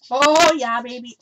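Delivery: loud burst of delight on "Oh, yeah"; thrilled that the marker works; excited. Real, not acted. Crafter delighted by a marker test, loud excited cry. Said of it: "Sorry, I'm getting excited".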